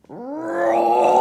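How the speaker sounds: loud playful roar; big storybook roar; monster roar. Acted. Monster roars.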